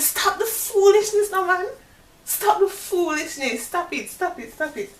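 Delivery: high, loud incredulous "stop the foolishness"; disbelieving protest. Retold or imitated. Refusing to believe her boyfriend is married, high and incredulous. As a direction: laugh it off as a joke you refuse to believe. Retold disbelief at his confession.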